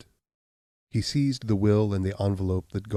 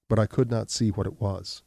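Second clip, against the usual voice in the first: ordinary delivery, nothing marked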